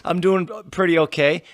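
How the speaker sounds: ordinary talk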